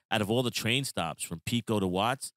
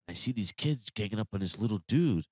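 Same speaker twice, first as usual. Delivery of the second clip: ordinary talk, nothing marked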